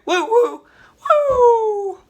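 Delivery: loud, very high-pitched "woo"; drawn-out falsetto whoop. Acted. Whooping in cabbie bit.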